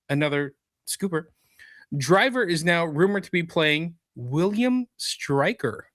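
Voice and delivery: presenter reading a rumor story; news read. Acted. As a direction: read it out like an entertainment news report. Reads entertainment news story.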